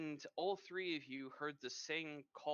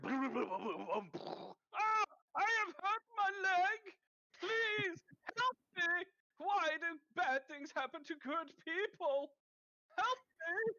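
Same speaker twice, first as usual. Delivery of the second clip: much higher, louder melodramatic wailing; hammed-up cry for help; 8 dB louder, slower than usual. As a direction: overact a man who has hurt his leg. Man fallen on the stairs.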